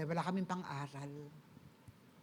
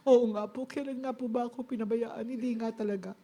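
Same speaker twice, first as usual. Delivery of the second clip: tearful gratitude; 5 semitones higher, 3 dB louder than usual. Retold or imitated. Answering Lord while crying on bus.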